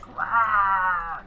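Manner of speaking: loud high roar, fully hammed; dinosaur voice. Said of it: "I'll be all like".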